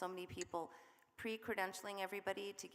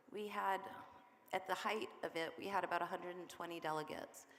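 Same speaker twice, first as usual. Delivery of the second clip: normal talking voice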